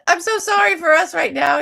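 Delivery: high, loud apologetic plea; earnest apology. Retold or imitated. Apologizing to a Canadian tourist, loud pleading voice. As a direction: pour out the apology. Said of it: "I said".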